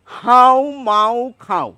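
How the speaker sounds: হাউ মাউ খাও drawn out slowly through the nose; slow nasal chant; nasal pronunciation. Shown as a demonstration. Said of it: "এটুকু আবার পড়ছি".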